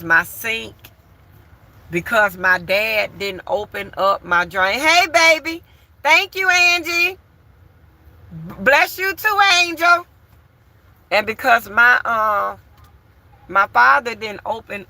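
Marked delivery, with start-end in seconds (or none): 4.8-10.0 higher, sweet thank-you voice; lifted, tender greeting to viewers. Real, not acted. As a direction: coo the thanks to the fans. Thanking viewers for gifts live.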